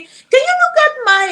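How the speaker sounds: high-pitched mock request; mimicked pleading request. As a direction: imitate her asking in a high voice. Mimics woman's request in retold message.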